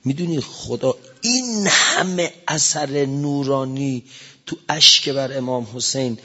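ordinary talk, nothing marked

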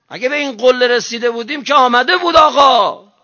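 high, loud, pleading sermon cry; impassioned preaching. Real, not acted. Preacher urging the faithful, high loud impassioned cry. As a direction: push every line up and out to the crowd.

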